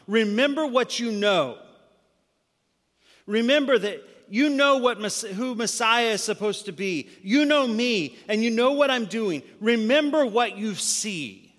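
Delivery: loud, urgent preaching of Jesus' words; preached at full force. Acted. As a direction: press it home loudly, like a plea. Jesus pleading with John, preached.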